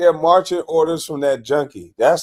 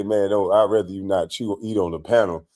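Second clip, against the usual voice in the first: softer, lower, mild polite ask; polite request; 8 semitones lower, 6 dB quieter than usual. Shown as a demonstration. Host showing the polite way to ask, softly. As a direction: show how to ask nicely and gently. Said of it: "all you gotta do is just say".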